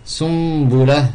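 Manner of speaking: Sumbula drawn out slowly, n sounded as m before b; slowly: Sum-bu-la, lips closed on the m; slow teaching Sumbula; slow iqlab demo. Shown as a demonstration. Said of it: "Ya un ba".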